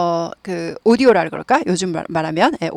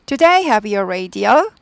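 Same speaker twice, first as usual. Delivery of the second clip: very slow, end goes up; slow yes-no question, rising close; rising tune; much slower than usual. Shown as a demonstration. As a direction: lift the end of "Do they have your radio?". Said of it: "연습해 볼게요".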